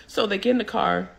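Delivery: usual speaking voice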